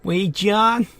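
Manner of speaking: put-on high, loud Boston bro accent; hammed-up Boston accent calling out. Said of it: "the best Boston accent I've ever heard".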